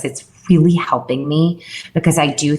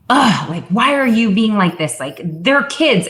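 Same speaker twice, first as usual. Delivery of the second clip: higher-pitched mock self-reproach; exasperated self-talk; 6 semitones higher than usual. Mom scolding herself over her kids, exasperated. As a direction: groan it out as exasperated inner scolding.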